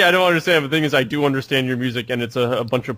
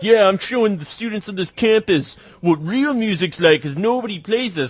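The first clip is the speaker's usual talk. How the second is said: snobby DJ; 6 semitones higher than usual. Retold or imitated.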